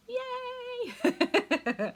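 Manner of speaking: squealing, laughing yay; excited cheer. Real, not acted. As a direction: cheer along with the crowd.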